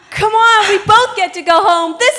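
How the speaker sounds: loud, high happy cheer; excited. Acted. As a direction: shout it out with joy. Celebrating going home together.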